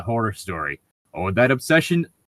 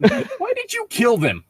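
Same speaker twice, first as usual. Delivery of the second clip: high, slow mock question; high-pitched, drawn-out mock interrogation; mock questioner; slower than usual.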